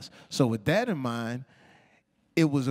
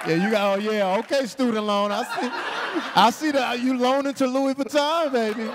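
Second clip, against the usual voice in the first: high, raised-voice teasing; mock calling-out; 9 semitones higher, 4 dB louder than usual. Host teasing a borrower about designer shopping, mock indignation. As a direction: call her out playfully, pitched way up.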